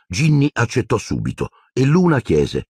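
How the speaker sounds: plain reading voice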